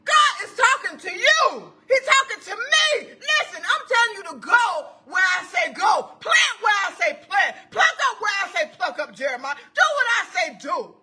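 high, driving pulpit cry; full-force preaching. Acted. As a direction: exhort the congregation with urgent commands.

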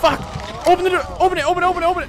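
loud frantic orders; panicked yelling. Real, not acted. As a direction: yell it, desperate. Panicked demand in chase.

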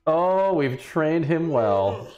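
ordinary talk

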